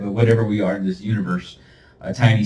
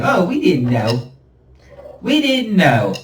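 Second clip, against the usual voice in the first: mock innocence; 7 semitones higher than usual. Mocking the abusers' excuse.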